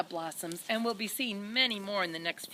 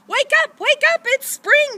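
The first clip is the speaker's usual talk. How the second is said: much higher, loud excited cheer; 16 dB louder than usual. A narrator's reading. Dog bounding into the baby's room, cheering loudly. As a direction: bark it out with joy. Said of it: "cheered the dog".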